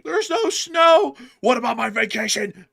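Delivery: high, slow, whining tourist; whiny complainer. Retold or imitated. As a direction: whine like a spoiled skier. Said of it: "a lot of people were complaining".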